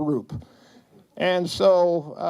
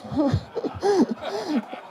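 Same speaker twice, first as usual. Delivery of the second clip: laughing; 11 semitones higher than usual. Real, not acted. Laughing at own story.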